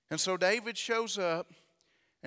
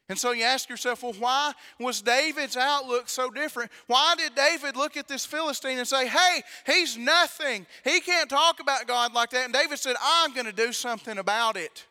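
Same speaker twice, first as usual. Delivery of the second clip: loud, higher preaching climb; preaching at full force; 6 semitones higher, 8 dB louder than usual. Acted. Preacher building to the congregation, loud and high. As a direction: drive it home to the congregation.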